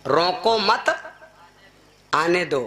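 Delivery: "रोको मत", pause, "आने दो"; pause after मत. Shown as a demonstration. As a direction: split the phrase after मत. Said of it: "कि उसका ना रोको हिया आवे दो".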